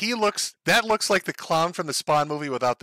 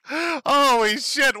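usual speaking voice

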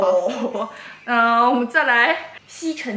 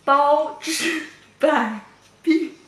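slow exaggerated mouthing; much slower than usual. Shown as a demonstration. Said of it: "看着啊".

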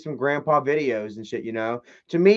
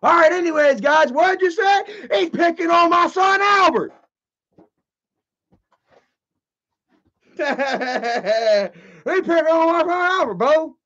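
high, loud whiny holler; Tina voice; 12 semitones higher, 4 dB louder than usual. Pretend. Tina complaining someone picks on her son, high loud whine. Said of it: "do a Tina D impression".

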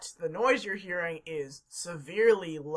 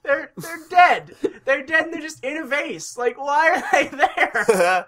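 laughing; 5 semitones higher, 5 dB louder than usual. Real, not acted. Exasperated laughing.

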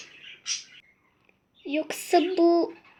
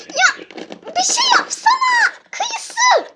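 much higher, louder squeaky begging; high squeaky plea; 4 dB louder than usual. Pretend. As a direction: squeak at your friend for help. Begs friend to intervene.

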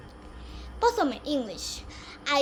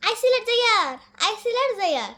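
Barishal accent, higher and louder; Barishal dialect; 5 semitones higher, 4 dB louder than usual. Shown as a demonstration. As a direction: say it the Barishal way, lifted up. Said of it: "আর বরিশালে কয়". (উঁচু গলায় বরিশালের টানে বলা)

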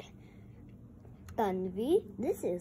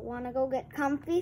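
plain reading voice

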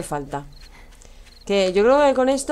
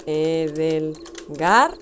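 slowly spelled out; 5 semitones lower, much slower than usual. Shown as a demonstration. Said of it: "Aquí te pongo, mira".

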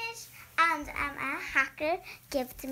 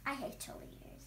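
whispered; 4 semitones lower, 10 dB quieter than usual. Real, not acted. Whispered dislike of cheerleaders.

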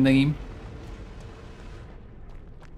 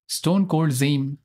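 slow name read; much slower than usual. Acted. Reading out an opponent's name.

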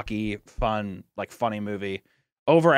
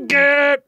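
mock cry; 10 semitones higher, 6 dB louder than usual. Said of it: "he's like".

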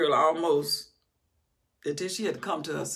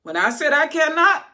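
loud, high declaration; ringing pulpit cry; emphatic preaching; 11 semitones higher, 10 dB louder than usual. Real, not acted. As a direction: proclaim it with force.

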